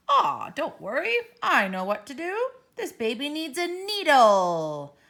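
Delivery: the doctor who knows everything; confident doctor. Acted.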